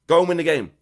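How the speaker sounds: loud rallying call, hitting go; rally them, punch the first word; fired-up command to the team; emphatic urging. Real, not acted.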